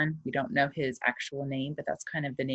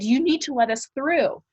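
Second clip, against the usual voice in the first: urgent demand; 10 semitones higher, 5 dB louder than usual. Acted. Aunt demands passage.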